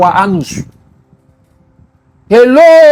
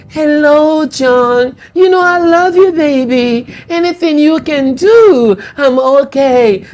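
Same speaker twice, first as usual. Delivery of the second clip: sweet-talking side chick; 3 semitones higher, slower than usual. Retold or imitated. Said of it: "They are going to use a very low voice".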